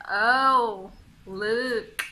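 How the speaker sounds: mock wonder with a drawn-out OH; admiring oh. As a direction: pretend to spot something beautiful. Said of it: "when you see something very beautiful".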